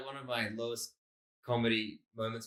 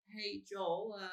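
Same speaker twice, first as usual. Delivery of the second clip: high, soft hesitant dad voice; Australian dad; 8 semitones higher, 6 dB quieter, much slower than usual. Retold or imitated. Dad asking son about the car, imitated high and soft. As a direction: tentatively ask a favour as an Australian dad. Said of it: "my dad's a sort of Australian dad".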